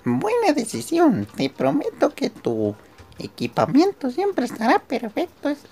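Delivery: high falsetto shopkeeper lady; man playing a chirpy woman, high up; woman's voice. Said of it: "se me va la voz haciéndole la voz".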